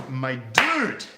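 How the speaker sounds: ordinary delivery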